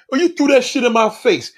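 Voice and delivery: mock the hurt accusation; indignant retort. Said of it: "and you be like".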